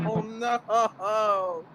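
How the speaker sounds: high laughing wail; crying laughing. Real, not acted. Watching Jimmy's head go boing, laughing. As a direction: let the laugh break the words. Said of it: "I'm crying".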